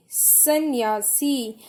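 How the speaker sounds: सन्यासी said slowly twice, syllables clear; teacher dictating सन्यासी; slow dictation. Shown as a demonstration. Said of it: "बड़ी की मात्रा".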